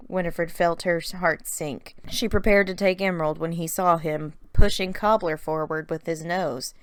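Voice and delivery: plain reading voice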